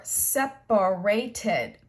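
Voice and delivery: "separated" slowed right down, every syllable apart: sep-a-ra-ted; four clear syllables. Shown as a demonstration. Teacher to students, counting syllables slowly. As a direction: stretch "separated" so each of its four beats is heard. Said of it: "It will have four".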